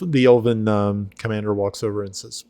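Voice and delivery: normal talking voice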